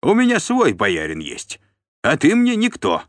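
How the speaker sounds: high-pitched, loud defiance; insolent. A narrator's reading. Steward disowning the voivode's authority, insolent. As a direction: dismiss him with brazen cheek. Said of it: "дерзко ответил Тиун".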